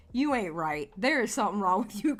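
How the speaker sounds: normal talking voice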